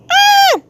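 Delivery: short, very high loud shriek; sudden scream. Real, not acted. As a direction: let out a quick high scream. Sudden scream while streaming.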